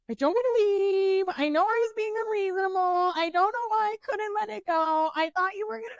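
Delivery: put-on high, whiny drunk apology; pleading whine. Begging her boyfriend not to leave, high whiny pleading. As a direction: beg him in a high whimpering voice. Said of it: "And I was like".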